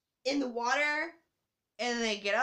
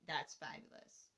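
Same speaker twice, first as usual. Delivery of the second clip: whispered aside; 8 semitones lower, 12 dB quieter than usual. Real, not acted. Whispered aside after Vader bit.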